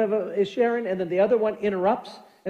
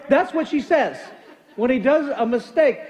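usual speaking voice

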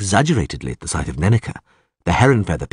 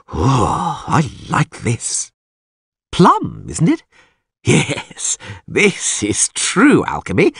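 high, theatrical, savouring voice; hammed-up bard, pleased and grandiose; delighted troubadour; 4 semitones higher, slower than usual. A narrator's reading. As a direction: relish the drink with drawn-out words and sighs. Voices Dandelion relishing the drink.

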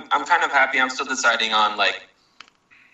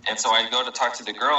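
usual speaking voice

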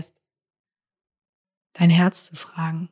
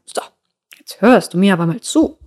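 stern demand; 7 dB louder than usual. Pretend. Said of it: "Es wird nicht sagen".

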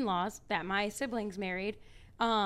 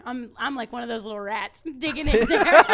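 laughing; 2 semitones higher, 12 dB louder than usual. Real, not acted. Self-mocking rat comparison.

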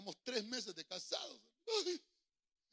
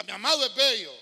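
louder, full-voiced, as if you mean it; said with conviction; 16 dB louder than usual. Shown as a demonstration. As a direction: proclaim it as true. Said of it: "dígalo como que es cierto".

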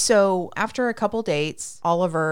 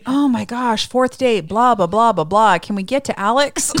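loud, higher mock exasperation; bored impatience; 4 semitones higher, 7 dB louder than usual. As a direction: rattle off the tedium, fed up and loud. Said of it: "I'm freaking bored".

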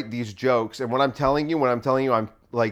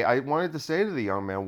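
ordinary delivery

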